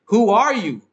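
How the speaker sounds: sudden high, loud what-the-hell; high startled who are you; shocked. Retold or imitated. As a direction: jolt up in disbelief at a stranger. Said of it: "they're like".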